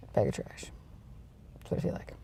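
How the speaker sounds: "Bag of trash" whispered, unvoiced and soft; whispered echo. Real, not acted. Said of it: "Like a bag of trash".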